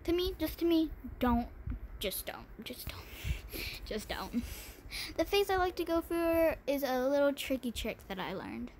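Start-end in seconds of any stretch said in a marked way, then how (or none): 2.0-4.4 slow, hushed whisper. Acted. Begging viewers not to use pink, whispered. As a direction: breathe it like a secret plea.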